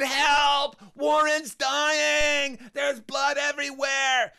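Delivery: high, drawn-out desperate shout; panicked cry for help. Acted. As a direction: scream the emergency through a closed door. Child yells for help at door.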